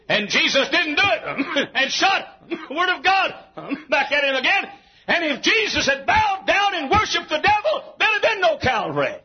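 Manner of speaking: high-pitched, exultant pulpit cry; full-force preaching. Real, not acted. Preacher telling of Jesus resisting the devil, full-force preaching. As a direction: preach it at the top of your range.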